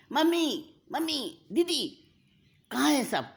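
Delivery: high, loud calls through the house; calling loudly through an empty house. Acted. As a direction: shout for mom and sister. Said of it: "ज़ोर ज़ोर से पुकारती है".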